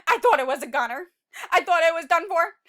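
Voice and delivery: lively, loud read-aloud telling; dramatic story narration. A narrator's reading. Teacher reading the seed's escape to children, dramatic narration. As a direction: tell it like an adventure to kids.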